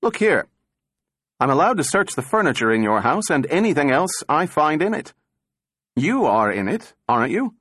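faint bluster. A narrator's reading. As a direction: puff up with shaky bravado. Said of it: "in a faint echo of bluster".

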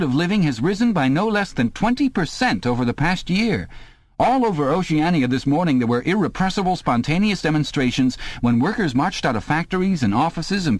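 high eager telescreen announcer; eager youthful announcer. A narrator's reading. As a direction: call out like a keen state broadcaster. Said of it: "cried an eager, youthful voice".

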